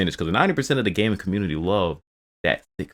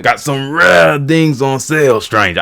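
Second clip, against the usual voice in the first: slow, loud, higher put-on merchant voice; merchant impression; 7 semitones higher, 7 dB louder, much slower than usual. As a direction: ham up the shopkeeper pitch. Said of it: "I kinda got it".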